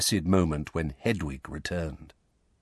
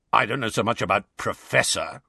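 growled. Acted. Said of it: "growled the voice".

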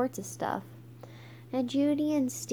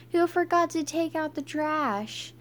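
higher jeering crowd call; mild mock yell; 6 semitones higher than usual. A narrator's reading. The crowd mocks the girls in garbage bags, mild yell. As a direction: call it out higher without really shouting. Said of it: "Everybody yelled".

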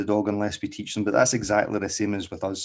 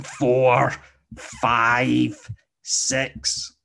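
growing impatience; 4 semitones higher, 3 dB louder, much slower than usual. Shown as a demonstration. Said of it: "that starts becoming an angry voice".

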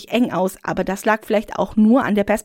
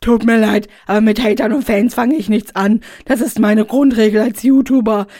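loud, self-satisfied character voice; smug YouTuber Rainer; 5 dB louder than usual. Acted. Rainer turning the women down, loud and smug. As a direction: brush them off like a smug YouTuber.

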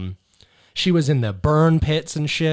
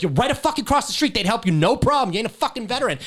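retold angry yelling; 10 semitones higher than usual. Airman yelling at a fake veteran outside a gas station, angry yelling. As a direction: chew him out like you caught a liar. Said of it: "I'm bitching him out".

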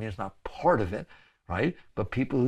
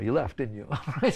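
ordinary talk

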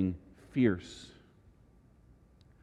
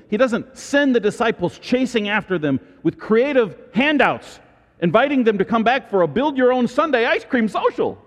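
louder, higher mocking build; preacher rising to a louder pitch; rising emphatic; 9 semitones higher, 6 dB louder than usual. Real, not acted. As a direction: build up to mock the idea of handouts.

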